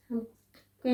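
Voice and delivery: normal talking voice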